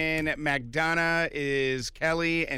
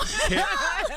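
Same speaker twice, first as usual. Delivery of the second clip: laughing; 14 semitones higher than usual. Real, not acted. Caller surprise.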